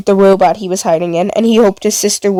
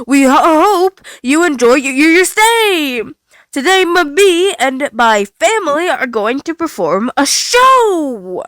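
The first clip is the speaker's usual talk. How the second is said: slow, high, stuttering animatronic voice; cheerful broken robot greeting guests; glitching robot host; 5 semitones higher, slower than usual. Acted. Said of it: "powering on and twitching a lot".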